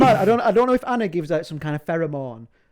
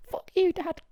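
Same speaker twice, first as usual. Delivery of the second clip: much higher voice, half laughing; mock teen defiance; 13 semitones higher, 4 dB quieter than usual. Teen plucking hair to spite dad, high laughing mock defiance. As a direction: spit it like a rebellious teen.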